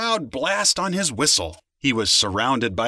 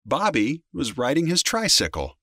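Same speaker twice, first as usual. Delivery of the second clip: usual speaking voice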